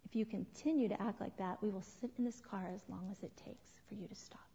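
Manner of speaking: hushed, controlled parental warning; quiet, low-key firmness; calm warning. Acted. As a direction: drop almost to a whisper, measured and unbothered. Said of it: "you calmly turn around and you say".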